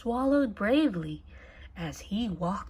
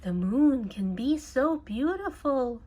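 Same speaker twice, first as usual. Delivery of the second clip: plain reading voice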